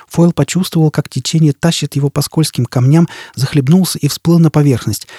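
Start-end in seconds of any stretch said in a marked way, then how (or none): none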